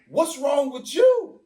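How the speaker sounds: high, slow, loud shout; mock shout. Friends shouting at the angry man, high and loud. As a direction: yell it at him in alarm. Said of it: "Y'all was like".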